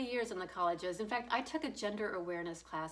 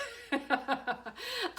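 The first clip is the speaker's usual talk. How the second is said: high laugh; 8 semitones higher than usual. Real, not acted. Laughing at her own vaccine-line joke.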